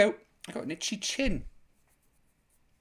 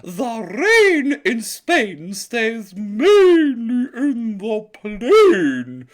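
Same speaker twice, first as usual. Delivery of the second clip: Eliza Doolittle elocution; 3 semitones higher, 8 dB louder, much slower than usual. Acted. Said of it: "in the words of Eliza".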